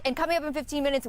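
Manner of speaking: ordinary talk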